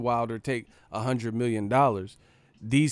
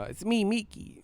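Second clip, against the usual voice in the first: higher-pitched mock skit voice, acting out both roles; 9 semitones higher than usual. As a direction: ham up the skit lines like a rap sketch. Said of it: "that D M X skit".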